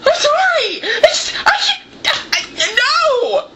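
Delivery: high choked apology; laughing and crying. Real, not acted. Overcome talking about the sequel, laughing and crying. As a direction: lose it mid-sentence.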